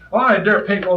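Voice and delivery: usual speaking voice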